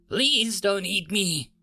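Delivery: high, slow frightened plea; whimpering. A narrator's reading. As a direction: whimper it like a scared boy's plea. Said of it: "Nicholas whimpered".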